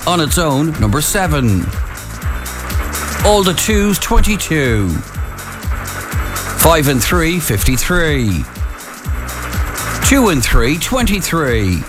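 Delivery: bingo caller. Acted. Calling bingo numbers on air.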